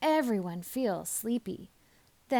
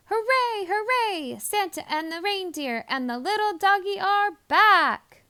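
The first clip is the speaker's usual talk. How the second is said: much higher, louder cheer; elves cheering; 8 semitones higher, 4 dB louder than usual. A narrator's reading. Elves cheer Santa's return home. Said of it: "the elves sang out a cheer".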